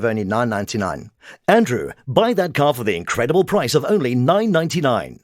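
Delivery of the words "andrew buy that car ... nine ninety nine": dramatic, projected announcer read; affected ad read. Shown as a demonstration. Coach demonstrating a car ad, affected and projected. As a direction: add muscle and drama to the sales line. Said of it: "The second was affected".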